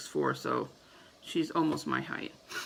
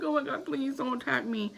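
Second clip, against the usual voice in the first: higher, mock-frightened plea to the spider; pleading with a spider, half-hammed terror; retold panicked plea; 7 semitones higher than usual. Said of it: "And I'm screaming outside".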